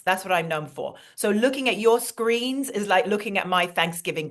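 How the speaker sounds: put-on chatty British accent; British-accented host addressing an audience; British alter ego. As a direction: play a gracious British guest speaker. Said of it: "bring my British alter ego".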